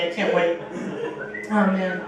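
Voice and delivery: ordinary talk, nothing marked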